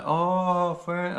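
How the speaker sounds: high, drawn-out mock Polish greeting; attempted Polish accent. Polish tout hailing a lone tourist, hammed-up Polish accent. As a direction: badly imitate a Polish street tout. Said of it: "I can't do a Polish accent".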